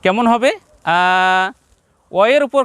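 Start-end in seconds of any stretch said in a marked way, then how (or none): 0.9-1.0 আ held long; sustained, steady আ; lengthened vowel. Shown as a demonstration. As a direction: draw out the vowel in আ. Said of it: "উচ্চারণ একটু লম্বা হবে".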